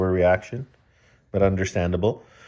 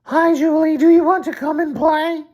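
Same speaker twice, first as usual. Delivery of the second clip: put-on very high squeaky boy voice; squeaky David voice; 7 dB louder than usual. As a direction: squeak it out like a cartoon kid. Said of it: "He said".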